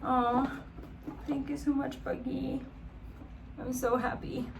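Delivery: high and soft, near tears of gratitude; touched and teary. Real, not acted. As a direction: thank him softly, welling up. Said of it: "I could literally cry".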